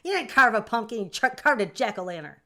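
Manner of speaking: playful loud scolding; mock yelling. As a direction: tease a chatter with a fake yell. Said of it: "I feel like yelling at you guys".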